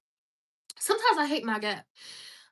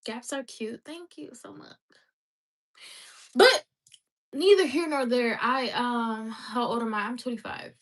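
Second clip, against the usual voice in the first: ordinary talk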